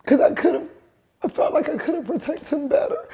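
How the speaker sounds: high tearful voice, a sob-laugh breaking through; crying. Real, not acted. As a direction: guilt spilling out through tears. Mother's guilt while crying.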